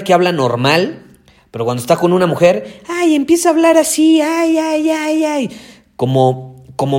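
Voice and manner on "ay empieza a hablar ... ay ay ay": mock high girly voice, much higher; exaggerated effeminate sing-song squeal; mocking falsetto. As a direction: imitate a guy going sweet and high around a woman. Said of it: "con una voz más femenina".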